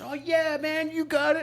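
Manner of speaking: very high, breezy encouragement; cheering strangers. Retold or imitated. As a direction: egg him on from behind the line. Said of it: "And the people behind us".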